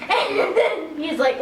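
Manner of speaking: ordinary delivery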